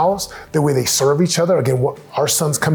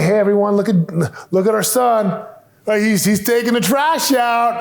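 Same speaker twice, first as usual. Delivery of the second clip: higher, bright public praise; proud announcement; 6 semitones higher than usual. Retold or imitated. Parent praising son in front of the family, mock proud cheer. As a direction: cheer him on in front of everyone. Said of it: "acknowledge it in front of the family".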